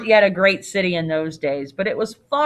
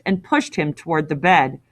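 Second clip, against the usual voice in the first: ordinary delivery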